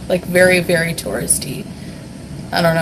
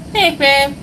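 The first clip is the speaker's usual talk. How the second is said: put-on baby voice; 6 semitones higher than usual. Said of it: "The one where I go".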